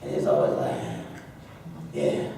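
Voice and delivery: deep, low aggressive cowboy grunt; mean cowboy voice. Shown as a demonstration. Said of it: "I changed my voice just a little bit".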